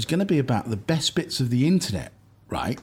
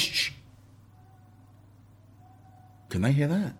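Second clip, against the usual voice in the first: hushed; 5 dB quieter than usual. Real, not acted. Hushing to listen to noise.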